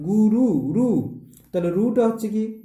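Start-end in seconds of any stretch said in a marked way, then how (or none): 0.1-1.2 গুরু, রু said very slowly, higher and louder; drawn-out syllables. Shown as a demonstration. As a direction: stretch out each syllable of গুরু and রু, raised and loud. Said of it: "এরপর হচ্ছে রু". (ধীরে টেনে উঁচু গলায় জোরে গুরু, রু উচ্চারণ)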